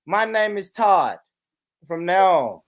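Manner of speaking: ordinary talk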